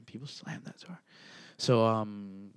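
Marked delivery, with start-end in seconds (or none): 0.0-1.0 breathy annoyed whisper. Real, not acted. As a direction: whisper it under your breath. Annoyed aside about noise.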